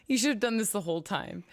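ordinary talk

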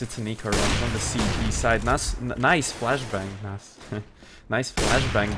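normal talking voice

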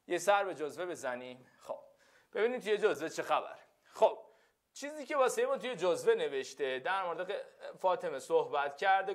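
ordinary talk, nothing marked

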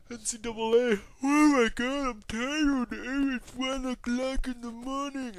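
high-pitched whine, drawn out at the end; hammed-up, high overtired moaning; mock exhausted whine. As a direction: whine it high, stretching the words out. Mock tired outburst, then corrects it.

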